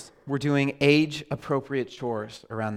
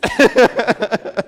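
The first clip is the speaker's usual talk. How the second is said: short laugh; 6 semitones higher, 5 dB louder than usual. Real, not acted. Laughs after the joke.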